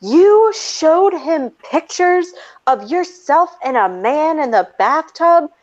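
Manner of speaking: high, appalled mock screech, slow at first; furious imitated parent. Mother accusing teacher over bathtub pictures, mock furious. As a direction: accuse in an acted-out outraged mother's voice.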